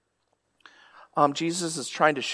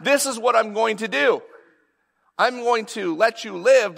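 high-pitched, agitated executioner; worked-up, flustered decree; freaked out; 9 semitones higher than usual. Acted. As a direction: blurt it in acted panic, pitched well up. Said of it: "he's freaked out by this".

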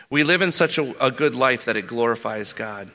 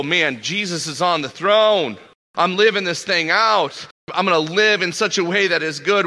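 eager believer; 6 semitones higher, 4 dB louder than usual. Retold or imitated. Preacher acting out a zealous believer.

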